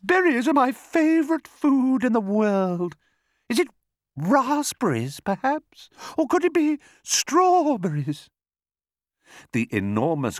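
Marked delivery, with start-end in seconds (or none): 0.0-8.3 very high, trilling bird voice; singsong bird. A narrator's reading. Roly-poly bird guesses berries excitedly, high singsong. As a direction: warble eagerly, stretching the fruit names. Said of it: "sang the roly-poly bird".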